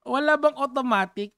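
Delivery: usual speaking voice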